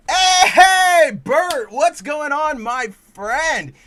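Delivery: high, booming hello to a friend; loud, exuberant shout-out; big loud greeting. Real, not acted. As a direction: holler a hearty hey to your buddy. Hailing Bert mid-talk.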